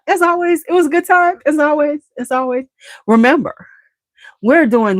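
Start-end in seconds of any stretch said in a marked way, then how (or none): 0.1-2.6 high sing-song. Real, not acted.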